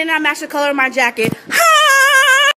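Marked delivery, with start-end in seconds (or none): 1.5-2.5 high, slow, stretched singsong "My name is"; mock intro. Friend roasting himself in a trend video, high drawn-out intro. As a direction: drag out "My name is" like a mocking introduction.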